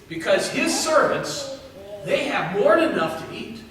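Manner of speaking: ordinary talk, nothing marked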